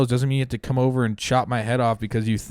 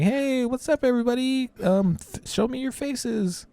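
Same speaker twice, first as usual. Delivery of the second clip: sing-song fake-cute hello to the camera; cheesy Snapchat voice; 12 semitones higher than usual. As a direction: coo at your followers like an attention-seeker. Mocks needy late-night Snapchat greeting.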